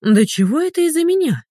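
high-pitched indignant protest. A narrator's reading. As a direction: leap up in pitch, affronted. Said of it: "возмутилась дамочка". (возмущённо, высоким голосом)

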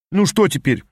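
grumbling. A narrator's reading. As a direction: grumble it, put-upon. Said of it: "проворчал юнец".

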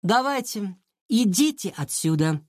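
put-on mildly bossy girl; ordering. A narrator's reading. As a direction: send them away, starting high. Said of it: "приказала Оля". (повелительно, но сдержанно)

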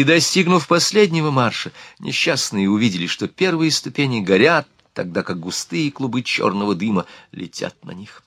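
ordinary delivery, nothing marked

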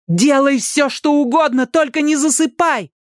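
high, insistent cry; urgent plea. A narrator's reading. Ali to a fading Danny, urgently pleading. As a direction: press him desperately to stay awake.

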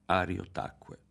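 usual speaking voice

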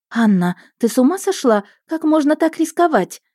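high, loud worried scolding; alarmed cry. A narrator's reading. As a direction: cry out in alarm at her sister. Sister rushes in, alarmed.

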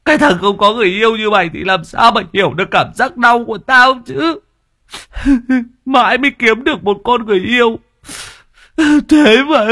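high, fast, tearful complaint; aggrieved whining. A narrator's reading. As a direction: whine it out, hurt and sulky. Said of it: "ấm ức". (ấm ức mếu máo)

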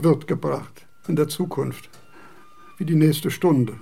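usual speaking voice

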